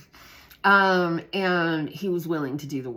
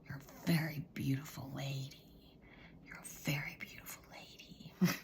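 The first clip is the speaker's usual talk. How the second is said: close whisper; 11 dB quieter than usual. Retold or imitated. The girl leaning close to the teacher, whispering a compliment. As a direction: lean in and whisper it sweetly. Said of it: "And she goes".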